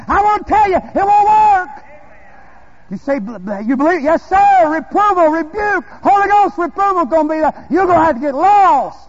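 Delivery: loud, high, emphatic pulpit cry; full-force preaching. Real, not acted. Preacher warning against easy salvation claims, full-force preaching. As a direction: thunder it out to the congregation.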